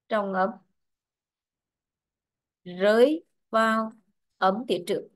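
slow dictation in short chunks; dictating. Real, not acted. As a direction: pause between phrases for note-taking. Teacher dictates the rule for notes. (đọc chính tả chậm, ngắt từng cụm)

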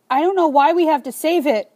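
high, loud, tearful protest; sobbing child. A narrator's reading. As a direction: cry it out like a worn-out child. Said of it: "The words somehow come out between halting sobs".

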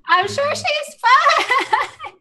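high-pitched, cheery denial; forced reassurance. Acted. Character in denial over friend's corpse.

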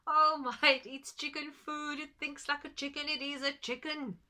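high, loud mocking jeer; cackling farmer ridiculing the friend. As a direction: farmer mocks the friend from below. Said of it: "doubled up with laughter".

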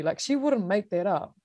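ordinary delivery

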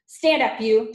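loud, high shouted order; peremptory headmistress yell; Trunchbull. A narrator's reading. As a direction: shout a command at a pupil. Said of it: "she shouted at him".

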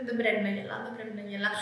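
hushed, holding back laughter; stifling a laugh. Real, not acted. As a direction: mutter it through suppressed giggles. Trying not to laugh.

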